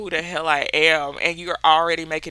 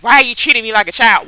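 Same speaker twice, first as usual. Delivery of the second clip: louder, rapid offended protest; mock indignant; 4 semitones higher, 8 dB louder, much faster than usual. Adult objecting to being talked down to, mock indignant. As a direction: imitate an affronted adult firing back.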